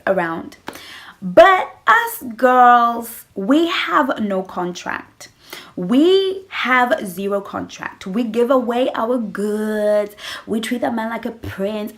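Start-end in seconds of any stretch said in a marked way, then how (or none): 1.2-10.2 slow emphasis. Real, not acted.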